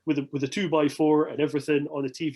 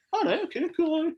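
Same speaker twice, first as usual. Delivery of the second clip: very high mock agreeing voice; high-pitched acceptance. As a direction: squeak out a retold meek okay. Retold reply to the reassurance.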